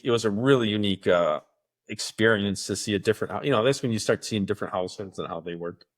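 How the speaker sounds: ordinary talk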